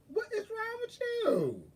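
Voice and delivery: very high, squealed mock wail; mock wailing question. Retelling his boo-hooing phone call.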